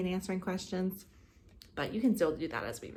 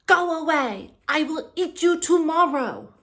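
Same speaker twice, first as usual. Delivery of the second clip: big dragon voice, much higher and louder; dragon roar; 10 semitones higher, 9 dB louder than usual. Acted. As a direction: boom it out like an angry dragon. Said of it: "the dragon said".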